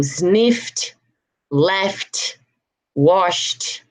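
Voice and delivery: "sniffed, left, washed" each ending on T; final T sounds. Shown as a demonstration. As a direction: say "washed" as washt, "sniffed" as snift. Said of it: "hear the T sounds".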